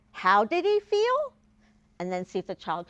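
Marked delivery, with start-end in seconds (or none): 0.1-1.3 slow, higher, rising "How did he feel?"; re-asked question. Shown as a demonstration. Teacher re-asking a child, slow and high. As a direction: ask again slowly and brightly, inviting the answer. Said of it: "re-ask the question".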